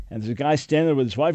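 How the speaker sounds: ordinary talk, nothing marked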